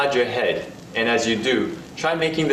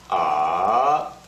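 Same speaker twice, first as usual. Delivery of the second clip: "ah" dipping low then rising; falling-rising "ah"; third tone; 5 semitones lower than usual. Shown as a demonstration. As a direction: dip "ah" down and bring it up. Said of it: "The third tone".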